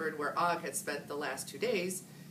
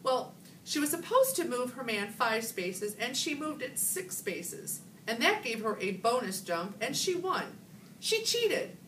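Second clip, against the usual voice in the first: put-on upset girl; 7 semitones higher than usual. A narrator's reading. Gail upset, accusing.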